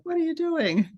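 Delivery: high incredulous "What are you doing?"; incredulous question. Retold or imitated. People questioning her choice, incredulous.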